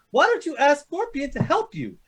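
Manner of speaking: ordinary talk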